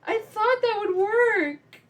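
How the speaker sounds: put-on high, slow, sheepish little girl voice; imitating a child's innocent excuse; innocent kid. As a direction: play dumb like a guilty child. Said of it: "I'm just sitting there like".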